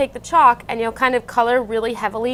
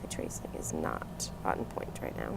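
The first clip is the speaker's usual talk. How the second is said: hushed aside under the breath; whisper; 15 dB quieter than usual. Real, not acted. Crafter whispering to herself about her messy tracing. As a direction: whisper it to yourself while tracing, a bit embarrassed.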